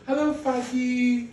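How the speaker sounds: put-on high, drawn-out child voice; sing-song high kid hello; daughter's greeting. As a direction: imitate a little girl greeting her dad, slowly. Voices daughter greeting her father.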